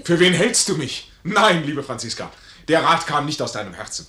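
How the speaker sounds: lauter und höher, empört; empörte Zurechtweisung. Acted. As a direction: weist sie entrüstet zurück. Major empört über Franziskas Rat. (laute, empörte Zurechtweisung)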